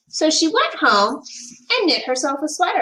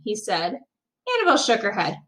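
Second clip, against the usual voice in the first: plain reading voice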